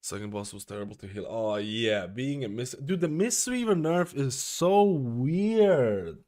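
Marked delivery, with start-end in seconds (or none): 2.8-6.2 exasperated. Real, not acted. Complaining about a class nerf.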